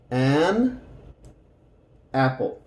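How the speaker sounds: An, a pause, then apple; separated an and apple. Shown as a demonstration. (separado: An, pausa, apple)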